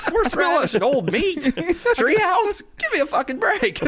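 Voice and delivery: laughing, scandalized disbelief; incredulous outrage. Real, not acted. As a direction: protest in high-pitched comic exasperation. Mock outrage at tasting notes.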